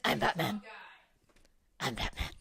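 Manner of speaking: low, quiet gravel Batman. Acted. Cohost demonstrates the Batman voice, low. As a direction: drop it low and dark. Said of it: "the voice where he goes".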